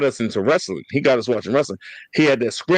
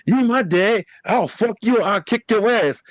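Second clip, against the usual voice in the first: raised-pitch, defiant backtalk; retold defiance; 7 semitones higher than usual. Younger sibling standing up to older brother, mock defiant. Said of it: "I stood up in there, I'm like".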